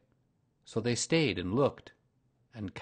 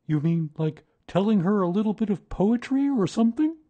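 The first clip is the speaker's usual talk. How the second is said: higher, louder eager bear; Pooh voice; 11 semitones higher, 9 dB louder than usual. A narrator's reading. Pooh suggests poetry to Rabbit, eager and higher. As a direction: brighten up with the poetry idea.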